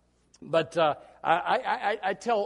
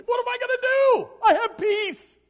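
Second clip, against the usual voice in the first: high, loud put-on voice of a fretful visitor; mock troubled counselee; 11 dB louder than usual. Pastor mimics an untroubled churchgoer, high and loud. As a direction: ham up a worried person seeking counsel. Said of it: "They don't come in and say".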